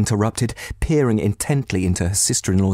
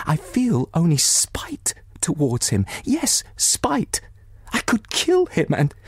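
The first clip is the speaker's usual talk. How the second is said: rising bitter rage; 7 semitones higher than usual. Acted. Dolly pouring out her spite for her husband to Anna. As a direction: pitch climbing higher into spiteful fury. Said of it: "her eyes lit up with hatred".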